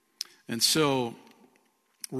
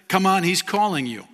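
louder, higher call; crowd calling out; 6 semitones higher, 7 dB louder than usual. Retold or imitated. Crowd urging the blind man on, calling out loud. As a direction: shout encouragement across a crowd.

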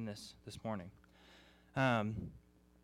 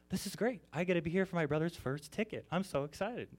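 higher, quick mock glee; gleeful brother; 7 semitones higher than usual. Brother gloating at his older brother's first ticket, mock glee. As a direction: tease like a delighted kid brother.

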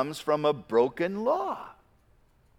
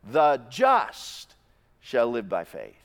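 "just" hit loud, then a drop; stress on just; 4 semitones lower, 3 dB louder, slower than usual. Shown as a demonstration. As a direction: punch "just", pause, finish low. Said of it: "each verse almost seems to emphasize a different word".

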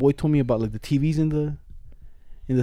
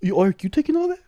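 higher, amazed; surprised; 10 semitones higher, faster than usual. Real, not acted. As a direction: call him out in disbelief. Calling out friend's big hit.

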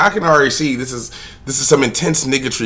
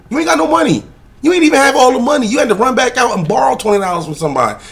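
heated rant; 12 semitones higher, 4 dB louder, faster than usual. Real, not acted. Ranting at the man.

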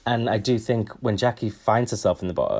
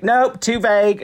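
higher, louder blunt dismissal; blunt call-out; 10 semitones higher, 5 dB louder than usual. Acted. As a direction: shut them down loudly, calling it out. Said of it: "and be like".

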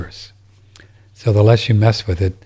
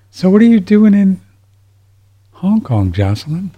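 mock surprise; 9 semitones higher, 3 dB louder than usual. Mock surprise at letter writer.

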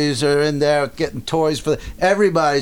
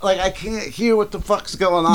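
normal talking voice

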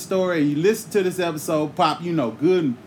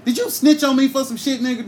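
usual speaking voice

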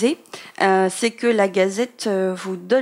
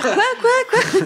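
high-pitched, laughing disbelief; mock bewilderment; 13 semitones higher, 3 dB louder, much slower than usual. Host replays her baffled reaction to a co-host's joke, comic high bewilderment. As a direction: squeak it out in comic confusion, pitch jumping way up. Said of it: "j'étais en train de me dire".

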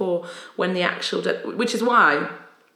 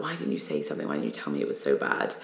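low concerned friend voice; 14 semitones lower, 5 dB quieter, faster than usual. Retold or imitated. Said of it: "they were like".

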